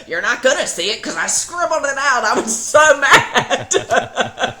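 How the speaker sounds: high, loud, laughing through it; laughing retort. Retold or imitated. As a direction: confess it while breaking into laughter. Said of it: "I go".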